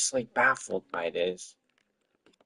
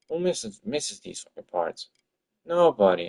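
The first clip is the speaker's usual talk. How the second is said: normal talking voice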